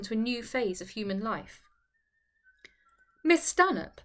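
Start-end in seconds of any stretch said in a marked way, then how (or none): 3.2-4.0 haughty, pitched high; offended hauteur, voice raised in pitch. A narrator's reading. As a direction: say the name with lofty disdain. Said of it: "said she haughtily".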